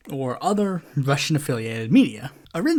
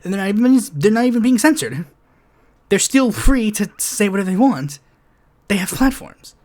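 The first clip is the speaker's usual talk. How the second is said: louder, higher ranting; heated emphasis; 5 semitones higher, 5 dB louder than usual. Real, not acted. Ranting at critics of Twitter's labels, louder and heated. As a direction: press the point hard. Rising rant.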